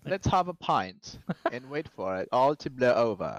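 put-on slow, amateur British accent; attempted British accent. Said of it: "gotta read it in his accent".

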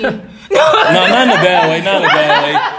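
laughing through the words; chuckling, half-apologizing. Real, not acted. Laughing reassurance to co-host.

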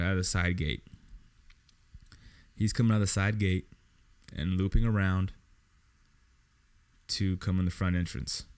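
normal talking voice

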